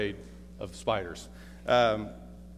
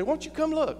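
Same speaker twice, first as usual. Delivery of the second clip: coaxing. Retold or imitated. Coaxing wife at canyon edge.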